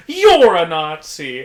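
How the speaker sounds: loud, slow pointed accusation; imitated accusing yell. Patient yelling at the janitor, loud and slow. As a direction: yell it slowly, pointing at him. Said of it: "she points at me and she goes".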